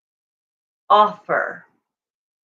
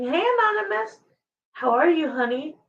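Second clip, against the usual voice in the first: sweet high mock greeting; 9 semitones higher, faster than usual. Mimics eager overeager applicant.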